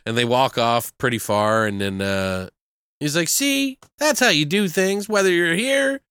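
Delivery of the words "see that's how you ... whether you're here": high-pitched, hammed-up moral lesson; imitated triumphant speech to the kids; mock proud lecturing. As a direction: proclaim it grandly, rising to a big finish.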